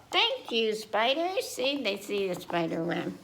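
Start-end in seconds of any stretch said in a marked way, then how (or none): 0.1-1.5 higher little pig voice; chirpy grateful child; Peppa voice. Acted. Peppa thanking spiders, high childlike voice. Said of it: "Then she says".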